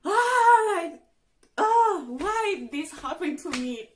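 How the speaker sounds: anguished wail. Retold or imitated. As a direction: wail why me at the sky. Panicking over positive test.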